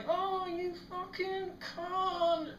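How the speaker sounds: long, high, drawn-out mock wail; tumbling wail. Steve rolling down the coal, high stretched-out mock wail. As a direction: imitate someone cursing all the way downhill. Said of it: "rolling down like that".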